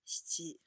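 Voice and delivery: 七 whispered as Japanese shichi. Shown as a demonstration. Teacher modelling 七 as shichi, whispered. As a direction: breathe 七 out voiceless as shichi. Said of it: "还有一个是七".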